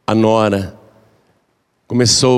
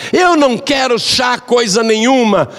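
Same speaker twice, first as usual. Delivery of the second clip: angry refusal shout; 9 semitones higher than usual. Acted. Mother-in-law shouting at daughter-in-law over the tea. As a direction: bark it out like a cranky old woman.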